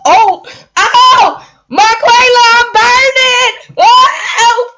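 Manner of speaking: high, loud, laughing cries of pain; hammed-up wail of someone on fire; playful shriek, Marquela, I'm burning; mock burning victim. Voicing a victim burned by her.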